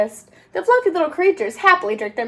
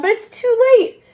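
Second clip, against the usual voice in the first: plain reading voice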